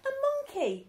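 very high yelp; yelled. Acted. Stew spotting the monkey, high yell. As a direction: yell it out in alarm. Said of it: "yelled Stew".

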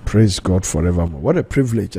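normal talking voice

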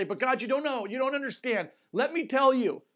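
high, loud pleading protest. Acted. Elijah pleading loudly with God he doesn't understand.

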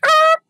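sharp high ah of frustration; exasperated ah. Retold or imitated. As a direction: burst out in exasperation. Bus driver's exasperated outburst.

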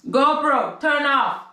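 high-pitched call to a device. Real, not acted. Retrying the GoPro command, high insistent voice.

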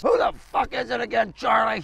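ordinary delivery

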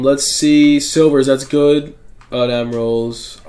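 usual speaking voice

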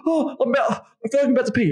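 imitating the woman about to climax; woman on the edge. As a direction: play her gasping alarm. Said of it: "And she's like".

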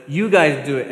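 mock desperate shout; father begs disciples, loud plea; pleading call.

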